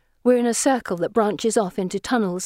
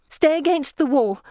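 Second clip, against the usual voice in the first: urgent warning; 6 semitones higher than usual. A narrator's reading. Warning on perilous steps.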